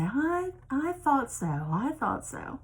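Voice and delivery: slow, knowing Southern drawl, lightly done; a hint of a slow Southern drawl; mock Southern drawl. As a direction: draw it out like a knowing local. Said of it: "They say".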